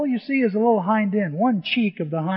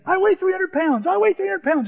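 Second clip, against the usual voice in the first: high-pitched, insistent repetition; hammed-up frantic objection, pitch way up; alarmed protest; 11 semitones higher, 4 dB louder than usual. Player refuses to go down the rope, alarmed. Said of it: "he kept saying that".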